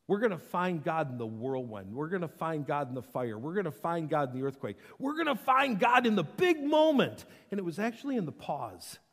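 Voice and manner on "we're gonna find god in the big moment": high, loud burst of hype; hyped-up proclamation. Acted. We expect God in the action, loud and hyped. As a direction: blast it out like the climax of a list. Mimics craving for big action.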